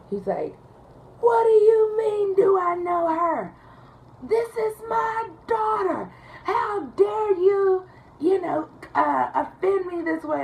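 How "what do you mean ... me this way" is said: much higher, louder, slow mock outrage; outraged. Caller taking offence at the dispatcher's question, hammed-up outrage. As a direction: play the offended father to the hilt.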